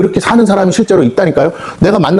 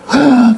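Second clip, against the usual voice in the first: sharp gasp; 8 semitones higher than usual. Acted. Moment of realization.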